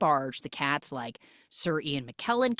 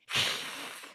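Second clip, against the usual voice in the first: voiceless hissing breath; cat hiss; 5 dB quieter than usual. Retold or imitated. Cats still reject the outcast, imitated hiss. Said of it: "we shouldn't hiss at her".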